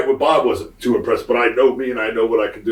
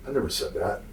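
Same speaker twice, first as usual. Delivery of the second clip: muttered, much softer; quiet retort; 3 semitones lower, 9 dB quieter than usual. Retold or imitated. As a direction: say it low and flat under your breath. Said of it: "I said".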